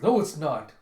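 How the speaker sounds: curt refusal. Retold or imitated. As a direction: shut the praise down hard. Gunte rejects praise angrily.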